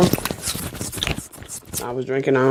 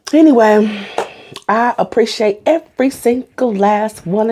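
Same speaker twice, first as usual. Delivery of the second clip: excited; 6 semitones higher than usual. Real, not acted. Thanking viewers for hundred subscribers.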